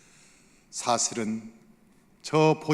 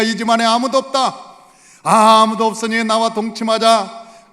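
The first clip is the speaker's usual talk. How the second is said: seductive wife; 6 semitones higher, 9 dB louder than usual. Retold or imitated. Potiphar's wife seduces Joseph.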